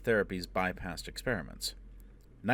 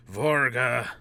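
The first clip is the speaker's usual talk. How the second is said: hoarse cry, higher and much louder; croaked cry; 5 semitones higher, 11 dB louder than usual. Acted. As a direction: force the name out in a croak. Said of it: "Foyle croaked".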